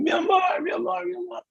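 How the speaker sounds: very high, strained mock scream; excited calling. Running after her boyfriend at the airport, squealed calling. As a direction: squeal it out while running to a lover.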